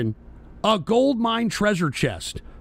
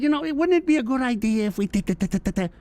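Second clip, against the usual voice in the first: nonchalant mock impression, trailing into nonsense; Trump; 3 semitones higher, faster than usual. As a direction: toss it off casually, imitating offhand musing. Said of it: "nonchalant and he'd be like".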